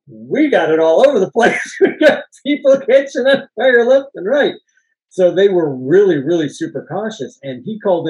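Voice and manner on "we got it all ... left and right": louder, higher quote with a laugh in it; animated, laughing mock exclamation; excited retelling. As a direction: imitate someone marvelling at the chaos. Retelling stunt woman's excited remark.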